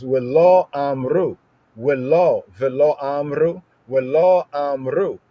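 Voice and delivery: "Velo amru" chanted slowly, syllable by syllable; reading Hebrew aloud. Shown as a demonstration. Teacher reading the verse to the class in Hebrew, slow and careful. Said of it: "In verse eight, it says".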